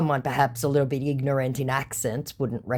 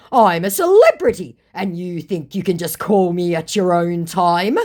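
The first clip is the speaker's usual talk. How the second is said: loud, raised-pitch self-important outrage; indignant; 5 semitones higher, 7 dB louder than usual. Retold or imitated. Fake celebrity scolding someone who called him, indignant. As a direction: play the affronted fake celebrity. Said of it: "completely indignant".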